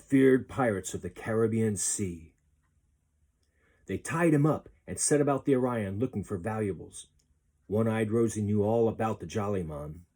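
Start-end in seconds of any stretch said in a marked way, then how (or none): none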